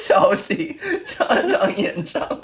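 title drawn out mid-giggle; laughing. Real, not acted. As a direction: savour the phrase through laughter. Repeats the title, still laughing.

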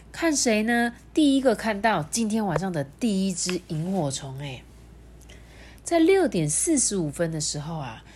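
put-on reading to a toddler. Narrates picture book to child.

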